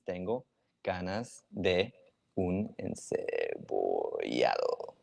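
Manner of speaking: slow, word by word, as if dictating; slow dictation. Shown as a demonstration. Teacher writing the phrase in chat for viewers, slow dictation. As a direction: spell out "Tengo ganas de un encebollado" slowly while typing it. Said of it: "I'm gonna write the sentence".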